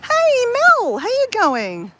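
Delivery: high, bright call of her name; excited greeting. Real, not acted. Greeting her friend Mel on the live, an excited call. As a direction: call out Mel's name gladly.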